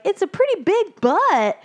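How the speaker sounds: high-pitched little fish voice; awed young fish. Retold or imitated. Young fish sizing up the boat with friends, high awed voice. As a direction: pipe it up high like an amazed kid.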